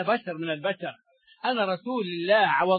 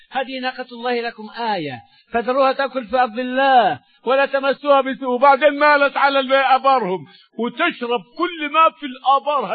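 raised, louder preaching climax; full-force preaching; 5 semitones higher, 6 dB louder than usual. Acted. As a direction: proclaim the verse with power.

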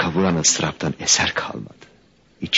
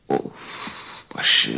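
low groan of a sore head; groggy and aching; dazed groan; 9 semitones lower, 3 dB quieter than usual. Acted. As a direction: groan it as you come round. Coming round with aching head.

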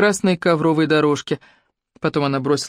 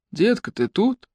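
fading in through static; 5 semitones higher, slower than usual. Acted. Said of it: "сквозь помехи пробился голос Хитча".

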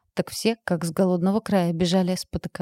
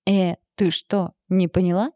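ordinary delivery

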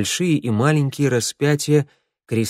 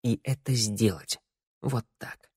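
firm quiet resolve; 8 dB quieter than usual. Acted. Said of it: "твёрдо сказала она сама себе".

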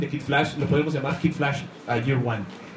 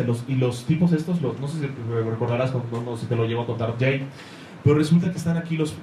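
ordinary talk

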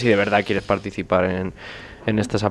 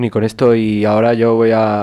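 ordinary talk

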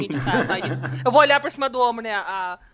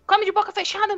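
high-pitched scolding motherly voice; 11 semitones higher than usual. Acted. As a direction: scold the boy like a mother hen. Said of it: "Não, foi grosso".

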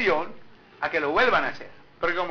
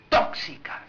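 whispered; slower than usual. Shown as a demonstration. Repeating the word tóxicas.

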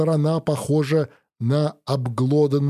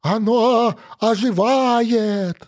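wheezing over headset; 7 semitones higher, slower than usual. Acted. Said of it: "захрипел наушник".

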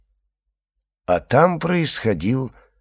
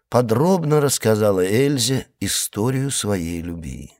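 plain reading voice

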